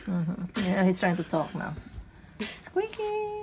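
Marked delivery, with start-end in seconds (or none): 2.6-3.4 much higher call of the pet's name. Real, not acted. Owner calls the pet, high and sing-song.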